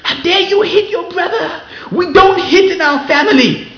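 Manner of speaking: high, loud scolding yell; imitating a yelling father. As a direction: mimic a hypocritical father shouting at his son. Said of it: "He's going".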